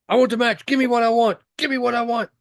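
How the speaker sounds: high-pitched repeated mock demand; high, insistent meme voice; Batista. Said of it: "Batista being like".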